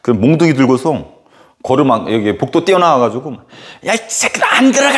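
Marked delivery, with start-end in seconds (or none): 3.9-5.0 a furious head teacher roaring down the hall; retold shout. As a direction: bellow them back into class. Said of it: "막 소리 지르고".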